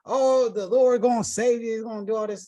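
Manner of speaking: high, loud, hammed-up church preacher; mock magnificent prayer. Imagined prophet praying over Naaman, mock-grandiose and high. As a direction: wail it out like a showy faith healer. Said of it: "say this magnificent prayer and be like".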